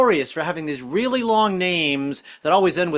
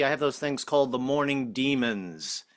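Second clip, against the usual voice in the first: normal talking voice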